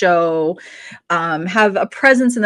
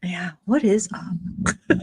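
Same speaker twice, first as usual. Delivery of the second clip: slow breathy whisper, drawn out; 5 dB quieter, much slower than usual. Acted. Co-host whispering back a hello to Rob. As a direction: hush it right down and stretch every word.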